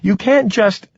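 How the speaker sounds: high-pitched protest, broken off. A narrator's reading. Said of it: "nothing to be gained by raising her voice".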